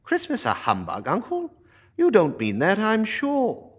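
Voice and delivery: high, loud, disbelieving nephew; cheerful nephew who can't believe it; surprised young nephew. Acted.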